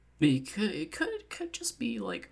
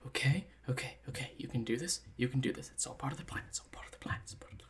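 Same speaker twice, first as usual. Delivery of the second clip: muttered self-reassurance; 7 dB quieter, faster than usual. Acted. Character steps aside to calm himself, muttering into a whisper. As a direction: talk yourself down, ever quieter.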